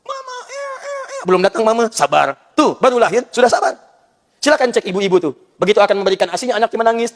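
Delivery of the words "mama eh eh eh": put-on high, far-off babyish whimper. Preacher playing a newborn calling for mama, high baby whimper.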